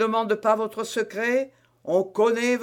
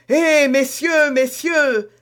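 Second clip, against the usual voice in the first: higher, louder and slow, bystanders breaking in; calling out to stop them; 5 semitones higher, 5 dB louder, slower than usual. A narrator's reading. Onlookers call out to stop the quarrel, loud and high. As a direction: cry out to part the quarrel. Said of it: "s'écria-t-on autour d'eux".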